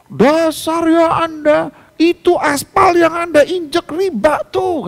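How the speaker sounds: mock furious sales caller; angry salesman. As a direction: imitate an irate salesman snapping back. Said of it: "katanya dia".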